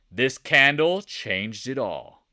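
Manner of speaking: slow, loud dramatic announcement; big drawn-out pronouncement; emphatic declaration. Shown as a demonstration. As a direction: proclaim it slowly and loudly. Emphatic statement before whispered echo.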